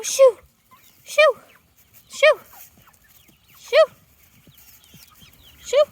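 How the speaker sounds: loud, high shoo at the chickens; shooing shout. Real, not acted. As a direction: shoo them off with a sharp call.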